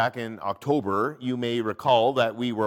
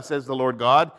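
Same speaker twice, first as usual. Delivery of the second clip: ordinary talk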